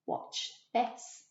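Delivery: hushed unvoiced whisper; whispered "watch this". A narrator's reading. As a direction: breathe it as a secret. Wolf conspiratorial in story.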